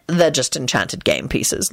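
ordinary delivery, nothing marked

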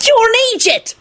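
very high-pitched scornful jeer. A narrator's reading. Tim insulting Alex, scornful. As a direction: announce it with scorn, voice pitched right up. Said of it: "Tim announced scornfully".

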